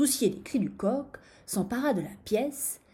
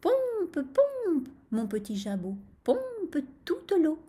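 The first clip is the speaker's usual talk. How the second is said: high, stretched-out chanting on the verb; rooster's muttered spell; 8 semitones higher, slower than usual. A narrator's reading. Rooster urges his crop to pump, muttered chant. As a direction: chant it like a little magic charm. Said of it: "se mit à marmotter".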